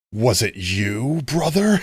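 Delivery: slow, drawn out, climbing high at the end; mock-dramatic accusation. Streamer playfully accusing a brother, mock-dramatic. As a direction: ham up the suspicion, stretching it out. Acted accusation, then laughs.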